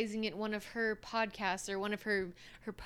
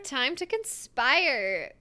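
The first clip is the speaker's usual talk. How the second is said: slow dramatic opener; 3 semitones higher, 4 dB louder, slower than usual. Acted. Slow ominous show opener.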